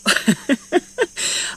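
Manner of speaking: airy breathy laugh, half a sigh; rueful laugh. Real, not acted. Mum working from home laughs at the pressure, airy rueful laugh. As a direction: laugh it off so you don't cry. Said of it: "I've got to laugh".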